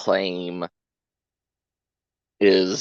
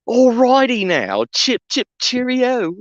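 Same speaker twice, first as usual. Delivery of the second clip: high, hammed-up posh British accent; mock British accent. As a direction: overdo a chirpy British accent. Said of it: "perfect British accent".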